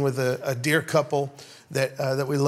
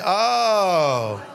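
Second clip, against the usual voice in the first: long drawn-out "oh" of understanding; stretched, sliding oh as it clicks; dawning realization. Shown as a demonstration. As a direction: model a group's falling "ohhh". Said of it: "Everyone say".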